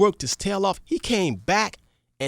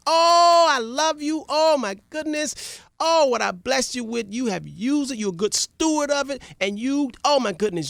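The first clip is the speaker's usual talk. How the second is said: higher, gushing delight; elated king; 6 semitones higher than usual. Acted. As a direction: gush over the good steward. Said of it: "same reaction".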